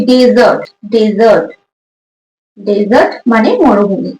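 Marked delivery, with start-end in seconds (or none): none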